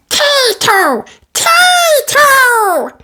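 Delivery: put-on very high excited toddler shout; baby George cheering. A narrator's reading. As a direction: squeal it like a tiny boy. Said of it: "cheered George".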